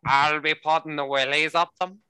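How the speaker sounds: mock-Belfast repeat of a threat; Belfast accent. Quoting the Irish workmate's threat back, in a Belfast accent. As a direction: put on the colleague's Belfast accent. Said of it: "he's from Belfast".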